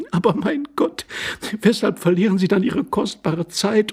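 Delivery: high, impatient feminine voice; interrupting lady. Acted. Lady urging the intruder not to waste time, high and impatient. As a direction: cut in urgently, up high. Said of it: "unterbrachen die Dame, indem sie zu lachen aufhörte".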